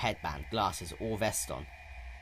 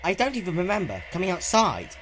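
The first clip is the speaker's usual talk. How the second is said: Spike confused; 10 semitones higher, 6 dB louder than usual. A narrator's reading. Spike talks to Twilight.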